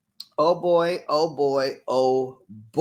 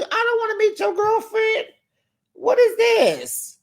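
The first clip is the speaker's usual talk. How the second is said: indignant disbelief. Real, not acted. Vlogger reacting in disbelief to the meet-the-girlfriend demand. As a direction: sound offended and amazed.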